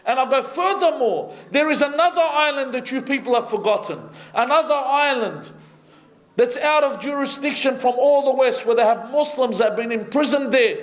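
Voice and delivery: high-pitched, urgent sermon delivery; impassioned preaching. Real, not acted.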